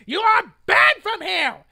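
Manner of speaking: loud booming order from a store worker; retold booming ban from the store. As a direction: boom it out like an angry manager. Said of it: "he said in a booming voice".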